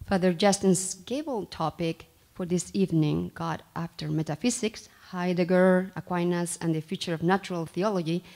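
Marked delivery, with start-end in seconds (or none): none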